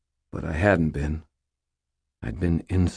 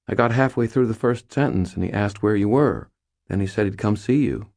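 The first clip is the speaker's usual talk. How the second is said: higher, louder character voice; lightly voiced friend, pitched up, defending herself; lightly voiced Molly, explaining; 6 semitones higher, 5 dB louder than usual. Molly explaining to Harry about Butters, higher-pitched.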